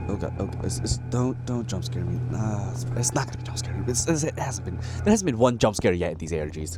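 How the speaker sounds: nervous, bracing for a scare; tense streamer dreading a jump scare; scared horror game streamer. Real, not acted.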